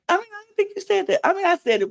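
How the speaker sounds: high, fast put-on voice; mock reply. Podcaster imagining the women admitting it, high fast mock voice. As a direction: breeze through it in a mock voice.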